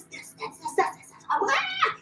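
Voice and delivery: sputtering then a high frustrated ah; overwhelmed cry. Retold or imitated.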